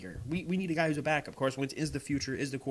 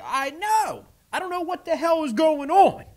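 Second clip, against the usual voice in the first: much higher and louder, throwing up his hands in disbelief; exasperated outburst; 12 semitones higher, 8 dB louder than usual. Retold or imitated. Fan retelling his reply to his friend's text, exasperated loud and high. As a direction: shout it like nothing makes sense anymore. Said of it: "I'm like".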